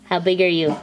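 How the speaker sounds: ordinary delivery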